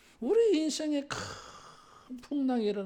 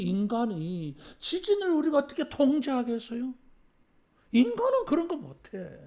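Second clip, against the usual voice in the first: 겁먹은 제자들 흉내; 4 semitones higher, 7 dB louder than usual. Acted. 겁먹은 제자들의 항변을 연기.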